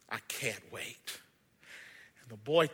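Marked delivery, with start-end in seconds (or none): 0.1-1.2 low, soft, slow longing; tender maker. Acted. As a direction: say it with deep affection. Maker says goodbye.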